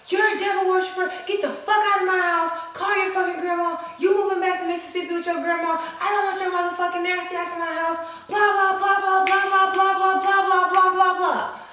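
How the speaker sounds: high, loud, rapid-fire rant; mom yelling, cussing. Retold or imitated. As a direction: imitate a mother's non-stop tirade on one high note. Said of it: "yells, cusses. She's like".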